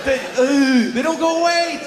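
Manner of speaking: strained near-crying whine; mock anguish. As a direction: wail it like a tortured kid. Anguished complaint continues.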